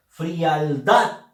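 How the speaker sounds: "frialdad" pronounced carefully, DAD emphasized; demonstrating "frialdad" with a strong last syllable; stress on final DAD. Shown as a demonstration. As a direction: push the voice onto the end of "frialdad". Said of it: "Pronunciamos, recuerda".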